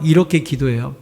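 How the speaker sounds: normal talking voice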